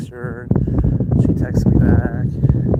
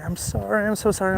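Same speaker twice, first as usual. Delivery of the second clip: higher, mildly tearful mock voice; imitating tearful apology; 7 semitones higher, 5 dB quieter than usual. Crying date apologizes to helper, lightly imitated. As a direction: quote her crying apology lightly, pitched up. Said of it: "she starts crying".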